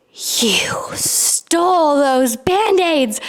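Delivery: slow horrified whisper; shocked whisper. Retold or imitated. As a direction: breathe it out slowly, aghast. Mom discovers the theft.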